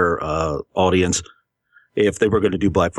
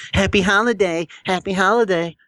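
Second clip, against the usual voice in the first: sing-song mockery, pitched higher; mocking parrot; 10 semitones higher than usual. Mocking a clerk's greeting to his co-host, sing-song sneer.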